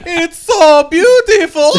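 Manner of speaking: high, loud, slow rapture; awestruck movie villain. Acted. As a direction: wail it in hammed-up ecstatic awe.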